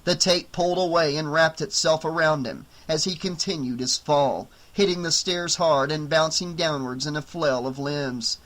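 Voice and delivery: usual speaking voice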